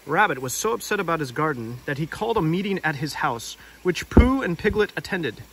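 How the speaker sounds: put-on lively read-aloud narration for children; gentle picture-book storytelling; storybook narration. A narrator's reading. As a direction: tell it like a bedtime story.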